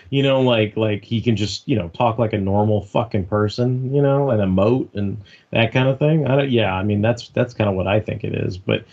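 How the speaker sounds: usual speaking voice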